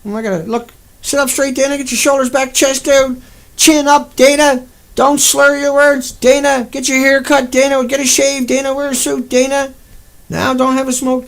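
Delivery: high, loud, nagging scold, mimicking John telling Dana off; mocking nag. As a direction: parrot every order in a loud, high mocking whine. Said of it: "John says".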